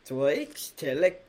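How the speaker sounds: slow, mock German "Deutsche Tele-"; bad German accent. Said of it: "That was truly despicable".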